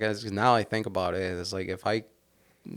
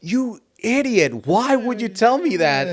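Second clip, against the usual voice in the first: loud, high-pitched reenacted scolding; retold outraged yell; 12 semitones higher, 9 dB louder than usual. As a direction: berate the passenger, replaying the outrage. Acts out scolding the passenger.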